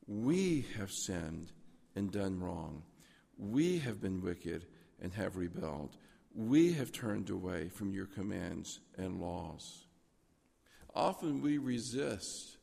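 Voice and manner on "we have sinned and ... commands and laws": each "we" stretched, then falling low and slow; slow, low confession leaning on every "we"; stress on WE. Retold or imitated. Preacher reading Daniel's prayer of confession, every "we" drawn out.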